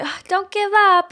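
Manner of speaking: high, slow, sing-song mock pep talk; a mocking, syrupy "don't give up"; mock encouragement. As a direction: parrot the empty advice back, hammed up. Mocking people's empty encouragement.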